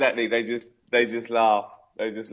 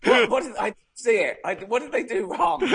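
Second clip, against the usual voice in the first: high-pitched mock bewilderment; baffled disbelief; 9 semitones higher than usual. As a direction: act out utter confusion, voice pitched way up. Acts out bafflement at diving judges.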